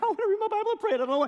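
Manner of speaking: excited. Pretend. Student suddenly eager to read the Bible, high and giddy. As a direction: gush with surprise.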